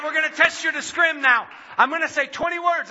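high-pitched, excited announcement; excited tester. Retold or imitated. Audiologist announces the word test to patient, excited. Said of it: "I can hear the excitement in her voice".

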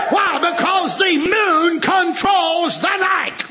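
shouted jubilant preaching; preaching at full force. Real, not acted. Preacher exalting the bride to his congregation, shouted preaching. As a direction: lift the bride up, ring it out.

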